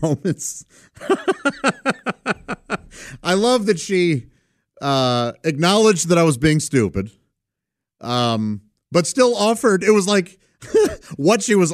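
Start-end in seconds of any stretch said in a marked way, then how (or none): 1.0-2.8 high delighted laugh. Real, not acted. Delighted memory of niece. 10.6-11.1 high burst of laughter. Real, not acted. Amused by niece.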